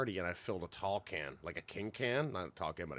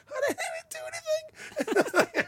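laughing; 5 dB louder, slower than usual. Real, not acted. Laughing at own misfortune.

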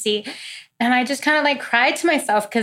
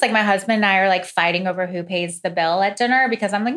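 ordinary delivery

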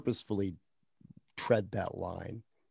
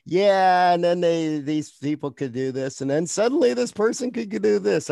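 mocking reader; 8 semitones higher, 8 dB louder than usual. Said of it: "Other people are like".

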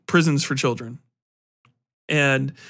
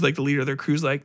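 usual speaking voice

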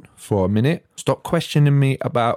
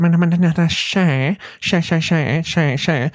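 mock pushy mate; 5 semitones higher than usual. Mocking drinkers urging him on.

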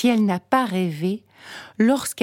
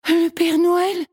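put-on high, loud joyful shout of a little girl; excited cry; 10 semitones higher, 4 dB louder than usual. A narrator's reading. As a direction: burst out with delight at spotting him. Girl spots Santa.

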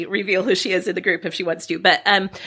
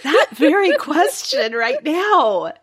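stifled giggles; 5 semitones higher than usual. Real, not acted. Still laughing after friend.

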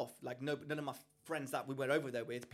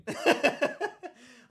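a sudden loud high-pitched laugh; high burst of laughter; 14 semitones higher, 11 dB louder than usual. Real, not acted. Amused at not tipping.

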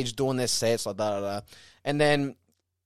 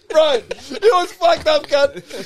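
high, loud, drawn-out exclamation through laughter; laughing, exasperated outburst; 11 semitones higher, 6 dB louder, much slower than usual. Real, not acted. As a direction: protest it while cracking up. Laughing at own awful story.

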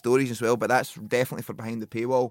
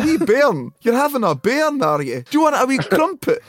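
high, loud hammed-up Scottish; over-the-top Scots, booming; gruff Scottish lord; 11 semitones higher, 8 dB louder than usual. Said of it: "Didn't he do that?".

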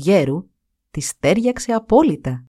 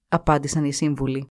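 ordinary delivery

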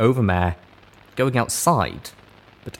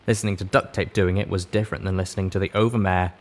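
usual speaking voice

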